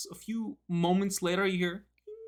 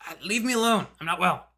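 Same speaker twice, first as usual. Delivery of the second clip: higher, strained sickly rasp; raspy voice; 4 semitones higher, 4 dB louder, faster than usual. Acted. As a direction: croak it like someone ill. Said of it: "a raspy voice from the other side".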